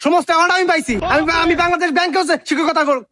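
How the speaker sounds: high-pitched, blustering self-defence; pompous, offended rant, pitched high; indignant outburst. Acted. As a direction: rail at the mother and boast of your career. Teacher quarrels with pupil's mother.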